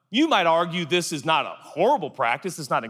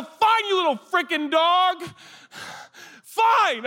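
high, drawn-out frustrated yell; hammed-up fed-up shout; exasperated outburst; 13 semitones higher, slower than usual. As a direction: give up on the dog in a high-pitched fit. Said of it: "I go".

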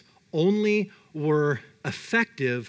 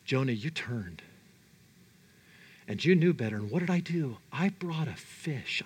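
low, soft, grave reproach; hushed reproach; 6 semitones lower, 5 dB quieter than usual. Acted. God recalling Jonah's turning away, quiet reproach. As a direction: drop down quietly and let the pauses hang.

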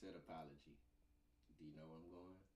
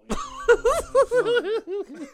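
laughing; 19 dB louder, much slower than usual. Real, not acted. Hype banter with laugh.

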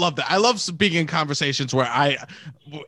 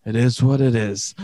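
low, subdued acceptance; resigned sympathy; 5 semitones lower than usual. Acted. To someone who says they have cancer, resigned and low. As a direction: offer it as helpless consolation.

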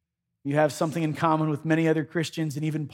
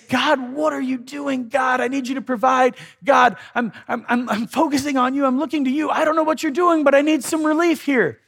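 desperate plea; 8 semitones higher than usual. Pretend. Preacher voicing desperate prayer.

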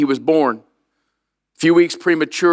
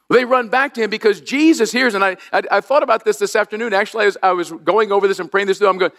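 usual speaking voice

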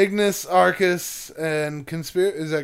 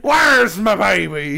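slow, louder Scottish pirate growl; 6 dB louder, slower than usual. Retold or imitated. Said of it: "This is like a Scottish pirate".

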